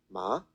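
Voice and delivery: "ma" rising as if asked; question-like second tone. Shown as a demonstration. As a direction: lift "ma" like a question. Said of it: "If you were asking a question".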